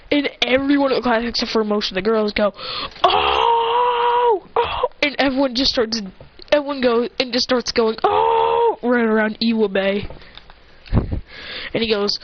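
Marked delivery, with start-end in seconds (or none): 3.0-4.9 high, held crowd "oh"; crowd "ooh". Retold or imitated. Imitating the class's reaction. 8.0-8.8 high, held crowd "oh"; crowd "ooh". Retold or imitated. Imitating the class's reaction.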